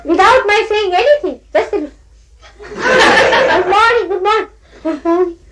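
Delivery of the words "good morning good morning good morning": high, slow, giggling good mornings; sing-song laughing hello; laughing mock greeting. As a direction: tease them with a chuckling, drawn-out greeting. Mocking guilty people's greeting.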